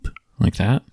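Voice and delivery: ordinary delivery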